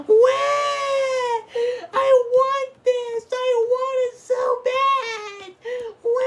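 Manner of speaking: put-on high, slow, wailing bratty child; hammed-up toddler bawling for a toy; fake crying kid. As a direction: wail it like a kid throwing a tantrum. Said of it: "they're gonna cry like".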